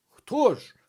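high, loud, startled demand; aghast, pitched sharply up; alarmed question. A narrator's reading. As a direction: press for the answer loudly. Horrified official presses the question.

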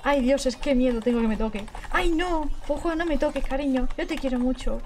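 ordinary talk

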